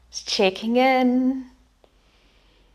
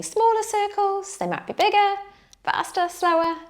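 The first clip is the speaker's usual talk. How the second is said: bright, much higher encouraging lilt; high playful voice; 10 semitones higher than usual. Shown as a demonstration. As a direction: lift the voice way up, playful. Yoga teacher offering options.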